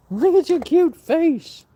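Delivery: very high, loud, slow coo. Real, not acted. Owner cooing over dog's cute face, high baby talk. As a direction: gush over the dog, drawn out high.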